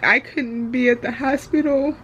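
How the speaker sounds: fighting back tears while speaking; crying. Real, not acted. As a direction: voice breaking, tearful and high. Emotional vlog about grandma, anxiety.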